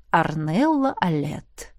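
low, slow, gentle naming; tenderly, drawn out; ласково. Acted. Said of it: "ласково произнёс моё имя". (ласково и медленно)

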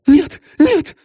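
put-on high, panicked young woman; high scared cry of no; frightened denial. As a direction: gasp it out in fright. Elsa shudders and answers.

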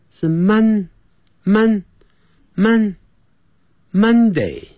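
慢慢拉长Mon-，Mon-，Mon-，Monday; slow drawn-out Mon. Shown as a demonstration. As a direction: stretch each Mon-，Mon- slowly before Monday. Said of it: "这样就对了". (慢慢拉长念Mon)

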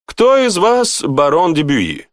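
high, slow formal enquiry; herald's call. A narrator's reading. Costumed herald seeking the baron in the hall, calling high and slowly. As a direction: call it out ceremoniously, drawn out.

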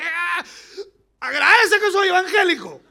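loud, high yell; hammed-up outburst at full volume; mock angry shout. Believer shouting back through the car window, mock angry shout.

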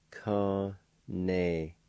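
Con-ne-iste stretched into separate long syllables. Shown as a demonstration. Teacher pronounces number two for learners, syllable by syllable. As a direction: sound out Con-ne-iste slowly. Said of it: "I'll pronounce these words".

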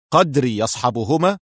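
ordinary delivery

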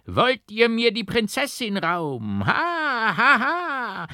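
a crazed man snatching the bride, shrieking; raving madman. A narrator's reading. The madman screams at the nuns, piercingly high and loud. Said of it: "kreischte der Rasende mit gellender Stimme".

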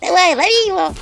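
high loud excited shout. Acted. Imp Anchutka shouting to catch the house spirit. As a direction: yell to catch him. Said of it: "кричала Анчутка".

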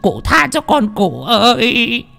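high, panicked begging with stutters; a frightened man pleading with a vengeful ghost; stammering plea. Acted. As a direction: beg the ghost for mercy, terrified. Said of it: "Lý Đẩm lắp bắp".

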